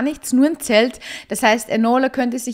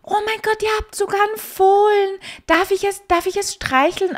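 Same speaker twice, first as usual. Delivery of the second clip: very high, gushing squeak; acted high-pitched swooning plea; melting with delight; 10 semitones higher than usual. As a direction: play a girl melting over a baby animal. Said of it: "Enola schmilzt jetzt auch vor sich hin".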